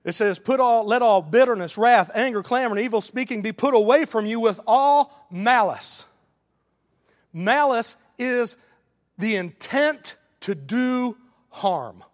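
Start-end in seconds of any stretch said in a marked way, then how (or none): 0.4-6.0 loud proclaiming of scripture; preacher booming out Ephesians; scripture proclaimed. Real, not acted. Preacher quotes scripture loudly. 7.3-12.0 slow, weighty definition; preacher defining malice slowly; slow emphasis. Real, not acted. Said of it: "defining slowly".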